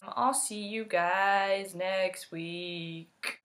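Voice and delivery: very slow, stretched farewell; drawn-out sign-off. Real, not acted. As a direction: stretch out each word of the goodbye.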